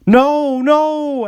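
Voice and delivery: very high and loud cry of no; Toby behind the doors, crying no in panic; high, frantic refusal. A narrator's reading. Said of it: "answered Toby".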